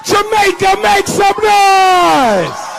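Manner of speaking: rally the crowd, long and loud; calling out to crowd. Real, not acted. Speaker calls on the Jamaican audience to cheer, loud drawn-out shout.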